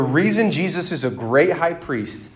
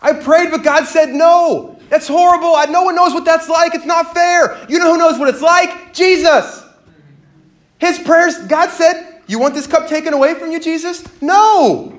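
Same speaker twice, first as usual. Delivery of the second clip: full-force preaching; 10 semitones higher, 6 dB louder than usual. Acted. To the congregation about unanswered prayer, full-force preaching. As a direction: preach it hard to the room.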